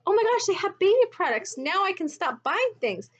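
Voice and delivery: high, giddy mock excitement; mock gushing. Vlogger mocking excited buyers of baby products, high gush. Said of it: "when they get things like".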